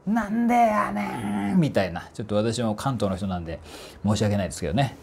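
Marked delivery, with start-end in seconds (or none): 0.1-1.6 high, drawn-out mock yell in fake Kansai dialect; imitated big-voiced tsukkomi. Voice trainer imitating a comedian's shouted comeback, mock yell. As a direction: stretch it out high like a comedian's screamed retort, without full volume. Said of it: "とんでもないでっかい声ですよ".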